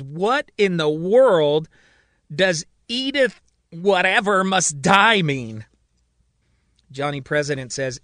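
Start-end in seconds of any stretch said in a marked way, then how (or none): 0.0-5.6 exasperated. A narrator's reading. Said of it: "Marion turns to Johnny".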